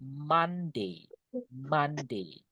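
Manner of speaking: "Monday" said soft and slow as "mande"; careful pronunciation. Shown as a demonstration. Teacher modelling "Monday" for students, soft careful. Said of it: "ini cara baca ya".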